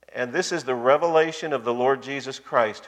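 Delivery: usual speaking voice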